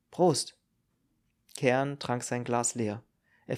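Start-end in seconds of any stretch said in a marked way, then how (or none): 0.1-0.6 higher, louder short toast; answering toast. Acted. Kern answering Marill's toast, lifted and louder.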